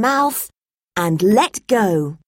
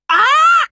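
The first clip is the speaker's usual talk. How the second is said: parrot squawk; 6 dB louder than usual. Acted. Parrot grabs with beak.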